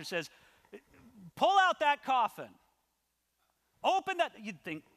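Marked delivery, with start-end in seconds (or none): 1.4-4.3 loud, high mock order; commanding. Pastor at a funeral ordering the coffin opened, commanding. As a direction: call it out like an outrageous demand.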